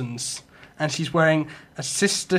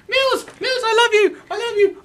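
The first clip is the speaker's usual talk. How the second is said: high mock lovesick cry; lovesick fan shouting; 3 dB louder than usual. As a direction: squeal it like a smitten fan. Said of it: "shout at Mills, going".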